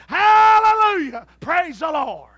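high, loud, slow hallelujah shout; hollering praise. Retold or imitated. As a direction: holler it down the street.

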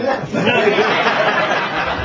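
a big, loud burst of laughter; a real belly laugh mid-routine; loud hearty laugh. Real, not acted. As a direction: laugh out loud at your own gag. Big laugh at his own joke.

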